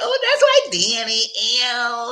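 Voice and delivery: squealed high, laughing "Danny"; laughing tease. Retold or imitated. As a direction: tease him in a shrill, giggling squeal. Mocking high-voiced teasing retort.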